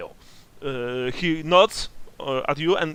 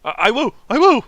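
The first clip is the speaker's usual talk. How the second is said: terrified; 9 semitones higher, 10 dB louder, faster than usual. Acted. NPC promising to leave town.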